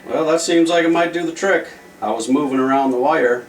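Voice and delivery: ordinary delivery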